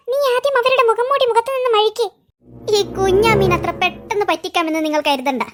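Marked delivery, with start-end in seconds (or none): 2.8-5.4 a low, sneering villain voice; menacing villain. Acted. Masked villain taunting the boys, low and sneering. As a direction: growl the taunt from low in the chest.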